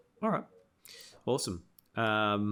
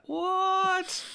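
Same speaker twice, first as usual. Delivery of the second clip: much higher disbelieving what; shocked; 3 dB louder than usual. Real, not acted. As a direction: cry out in disbelief. Shocked at frozen in fear.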